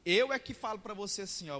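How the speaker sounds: normal talking voice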